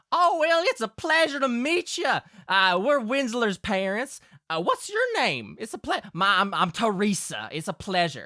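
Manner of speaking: high-pitched country ma, settling gradually; welcoming Southern mother. Acted. Game master plays Winsler's mother.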